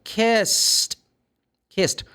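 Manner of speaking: kissed with a crisp final t; teacher modelling the past tense; model word kissed. Shown as a demonstration. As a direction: say kissed clearly as kist. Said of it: "as I said here".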